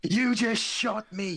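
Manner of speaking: high, slow indignant complaint. Acted. Orc complaining to Melgrim about being shot, indignant. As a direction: accuse him, drawn out.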